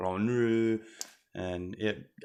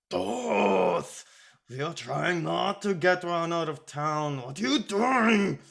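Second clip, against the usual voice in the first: high, exasperated scolding; chiding; 8 semitones higher, 3 dB louder than usual. A narrator's reading. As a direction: rein in a friend who is causing a scene. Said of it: "Agrippa chided".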